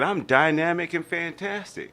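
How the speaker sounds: put-on high boastful child voice; proud kid. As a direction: brag like a proud boy. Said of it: "And I said".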